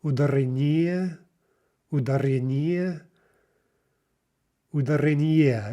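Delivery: "ударение" with the stress pushed late onto ни; misplaced stress. Shown as a demonstration. Teacher practicing mistakes with learners, "ударение" wrongly stressed on ни. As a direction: lean on НИ near the end of "ударение". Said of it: "let's practice mispronouncing, misplacing the stress".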